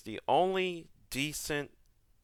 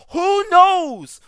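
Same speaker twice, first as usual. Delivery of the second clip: loud, high, exasperated yell; exasperated shout; 13 dB louder than usual. Real, not acted. Ranting to viewers about Palpatine, an exasperated shout. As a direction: throw it up to the sky in disbelief.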